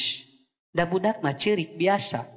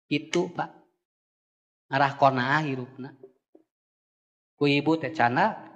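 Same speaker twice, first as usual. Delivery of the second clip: ordinary delivery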